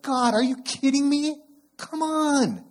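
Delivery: high, loud exasperated plea to God; frustrated at God. Retold or imitated. Pastor voicing his exasperated complaint to God, high and loud. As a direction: complain to heaven, fed up. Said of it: "directed to God".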